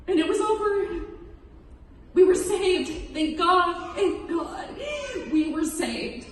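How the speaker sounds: high, tearful voice; real choked-up telling. Real, not acted. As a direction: tell it while fighting back tears. Reliving the rescue from drowning.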